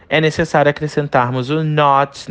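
ordinary talk

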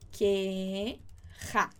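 "querrá" stretched into two parts, throaty rr; slow, low syllable-by-syllable "querrá" for learners; throaty double R. Shown as a demonstration. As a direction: draw out "que", then land a guttural "rrá". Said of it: "esse som aqui da sua garganta".

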